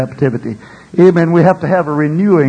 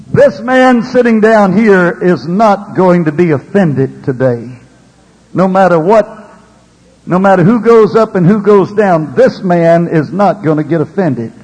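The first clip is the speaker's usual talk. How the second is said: loud, emphatic declaration from the pulpit; forceful preaching; 7 dB louder than usual. Real, not acted. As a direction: drive it home loudly.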